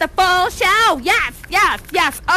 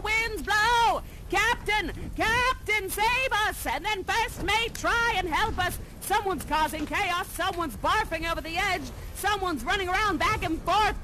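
frantic storm narration; 7 semitones higher, 7 dB quieter than usual. Acted. Acting out storm scene.

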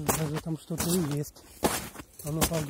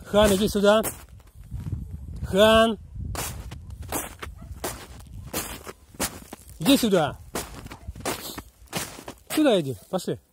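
loud, high call to come here; calling a dog; 5 semitones higher, 6 dB louder than usual. Real, not acted. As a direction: call the dog back firmly.

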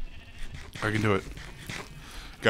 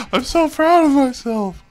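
very high, loud, gushing pride; overjoyed squeal; 14 semitones higher, 8 dB louder than usual. Real, not acted. Gamer proud he did it, high overjoyed squeal.

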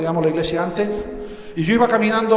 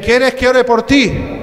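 slow, higher and louder offer; slow insistent offer; 4 semitones higher, 4 dB louder, much slower than usual. Retold or imitated. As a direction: drag out the offer to pray. Pastor repeats offer, slower. (oferta lenta, más aguda y fuerte)

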